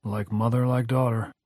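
ordinary delivery

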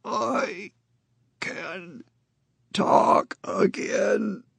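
high, slow, rasping croak; grating gravelly voice. Acted. Con rasps to Jacobs that he can talk, grating. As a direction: scrape each word out slowly like rusted metal. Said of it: "still in that grating, gravelly voice".

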